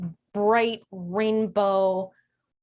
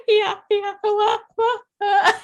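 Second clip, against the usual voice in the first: much higher and louder wah sounds; teary wailing imitation; 12 semitones higher, 7 dB louder than usual. Imitating her crying vocalizing, laughing at herself. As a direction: blubber the syllables out. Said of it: "funny to think back of".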